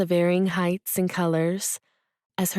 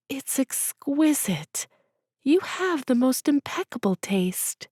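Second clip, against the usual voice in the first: awed whisper; 8 semitones higher than usual. Acted. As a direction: hush as if the hat might vanish. Aaliyah admiring hat in mirror.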